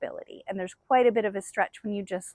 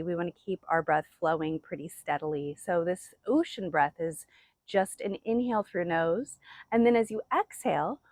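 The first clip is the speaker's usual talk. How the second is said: ordinary delivery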